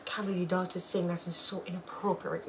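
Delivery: soft, muttered imitation of critics; mock disapproval. Imitating people who cuss about daughter's word, quietly disapproving. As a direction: drop the volume like a disapproving aside.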